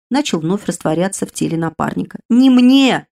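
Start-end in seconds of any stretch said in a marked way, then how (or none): 2.3-3.1 loud, higher barked retort. Acted. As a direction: snap it loudly so she jumps. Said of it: "рявкнул мой друг". (громко рявкнуть)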